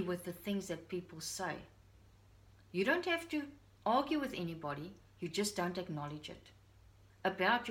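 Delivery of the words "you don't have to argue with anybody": normal talking voice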